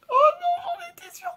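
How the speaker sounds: very high, loud squeal; squealing excited voice. Retold or imitated. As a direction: squeal it like a thrilled woman. Sister-in-law quoted reacting.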